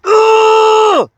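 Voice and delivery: explosive car scream; furious primal yell. Shown as a demonstration. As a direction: let all the rage out in one cry. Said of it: "you go from being like".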